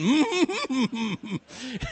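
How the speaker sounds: high quavering hum; teasing wordless squeal; mimicked whimper. Man mimics girl's noise.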